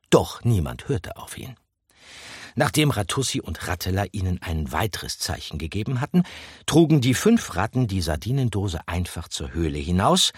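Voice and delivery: plain reading voice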